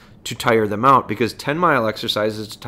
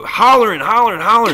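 mock hollering; 10 semitones higher, 7 dB louder than usual. Imitating the holler.

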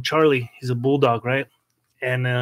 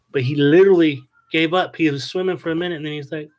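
ordinary talk, nothing marked

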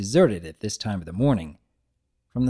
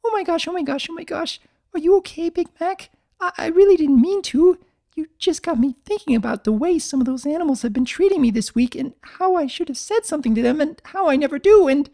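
high, panicked, rushing babble; frantic Fluttershy; 4 dB louder, faster than usual. Acted. Fluttershy panicking over hurt Big Mac, high and fast. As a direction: spill out apologies all at once. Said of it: "gnawing on her hooves".

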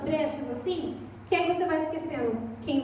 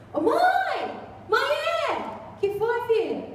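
high yelled call for mom; daughter calling out; 7 semitones higher, slower than usual. Retold or imitated. Daughter calls mother again.

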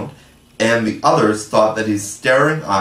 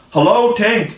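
much higher, louder greeting call; cried out; 11 semitones higher, 4 dB louder than usual. Acted. Boys greet Tinker Bell overhead, much higher louder call. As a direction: call up to the fairy in wonder. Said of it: "cried out the wondering boys".

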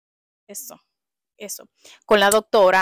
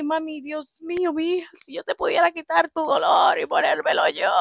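voz aguda de madre afligida; compadécete como una madre; madre angustiada; 8 semitones higher than usual. Retold or imitated. La madre consuela a la hija. (madre angustiada que consuela a su hija)

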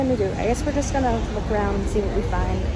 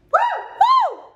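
excited cheer; 5 dB louder than usual. Real, not acted. Celebrating final night.

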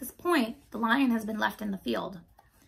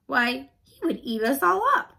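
higher, louder protest; alarmed cry; 4 semitones higher, 6 dB louder than usual. A narrator's reading. Mouse queen fears the lion eating mice, alarmed. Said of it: "cried the little queen".

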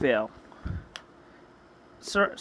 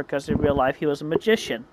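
usual speaking voice

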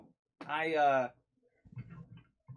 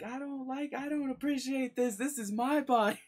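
high, whiny complaining voice; 9 semitones higher than usual. Retold or imitated. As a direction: whine like a sulky brat. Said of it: "you would have heard him talking like".

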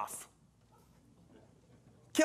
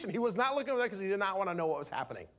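usual speaking voice

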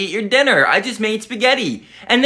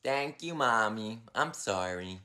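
much softer, lower, small apologetic voice; sheepish whisper-soft; 10 semitones lower, 15 dB quieter than usual. Acted. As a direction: hush it out, embarrassed. Calm after tantrum, sheepish.

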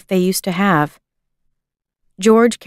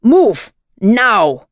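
louder, curt soldier's command; barked order; 2 semitones higher, 4 dB louder than usual. A narrator's reading. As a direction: snap the order at the girl. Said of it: "the soldier ordered".

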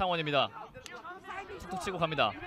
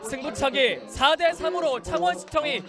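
triumphant announcement; 6 semitones higher, 5 dB louder than usual. Acted. Final win announcement.

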